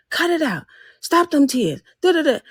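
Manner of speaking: put-on high, loud scolding of a crying toddler; scolding mom. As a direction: snap at the little boy to stop crying.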